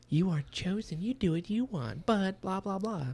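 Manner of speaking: high singsong imitation of a stock speech; mock lecture. Reader mimicking Leon's typical speech to Yumi, mocking singsong.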